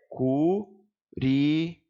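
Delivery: CU... RI, sílabas esticadas e lentas; separação silábica. Shown as a demonstration. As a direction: pronunciar "curi-" devagar, sílaba por sílaba. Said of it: "Vamos só entender a última?". ("curi-" dito pausadamente, cu - ri)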